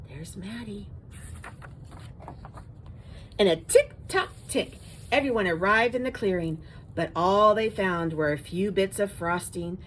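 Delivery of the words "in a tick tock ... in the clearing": plain reading voice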